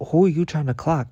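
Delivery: high, indignant challenge; sassy disbelief aimed at the teacher; incredulous sass. Retold or imitated. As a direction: call him out with mock outrage. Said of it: "I'm like".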